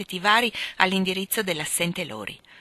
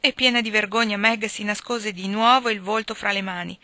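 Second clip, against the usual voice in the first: plain reading voice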